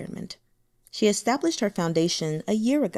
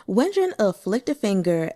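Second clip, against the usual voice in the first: ordinary delivery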